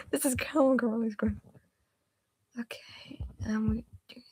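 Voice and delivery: soft focused whisper. Real, not acted. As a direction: whisper while concentrating.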